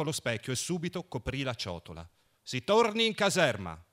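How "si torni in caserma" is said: mock-austere order, a bit higher and louder; stern military command. A narrator's reading. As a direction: play the stern officer, half joking. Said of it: "fece austero".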